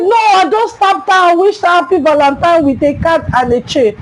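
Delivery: high-pitched indignant self-defence; hammed-up high protest; acted protest. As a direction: plead innocence in a high voice. Accused boy protests his innocence.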